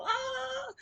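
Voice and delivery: sudden very high delighted cry; excited burst. Shown as a demonstration. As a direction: jump up with joy. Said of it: "I get excited".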